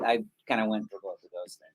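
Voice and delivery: normal talking voice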